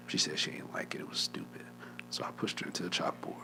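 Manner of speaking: soft, unvoiced admission; hushed confession. Real, not acted. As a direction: whisper the embarrassing part.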